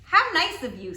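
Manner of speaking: sly wolf voice. Acted.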